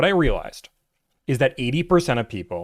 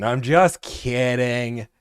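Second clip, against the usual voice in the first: slow reassurance; much slower than usual. Real, not acted. Repeats reassurance slowly.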